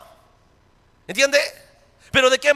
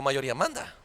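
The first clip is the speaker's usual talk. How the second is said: low, soft quoting of Nicodemo; quoted quietly; 9 semitones lower, 11 dB quieter than usual. Retold or imitated. Preacher quoting Nicodemo, low and soft.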